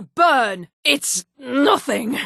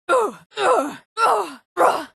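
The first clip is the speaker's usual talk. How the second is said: pained grunts; 9 semitones higher than usual. Acted.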